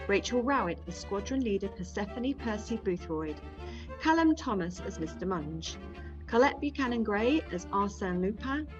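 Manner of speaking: usual speaking voice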